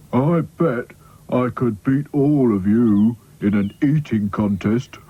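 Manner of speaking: slow, contented Sad Sack voice; Sad Sack musing. Acted. Sad Sack enjoying the refreshments, slow musing. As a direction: mull it over slowly, mouth full.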